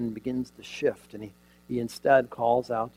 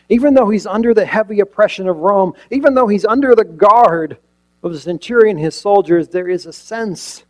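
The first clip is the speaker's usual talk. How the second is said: louder, higher, building sermon voice; forceful preaching; 9 semitones higher, 13 dB louder than usual. Acted. Preacher stirring the congregation, loud and rising.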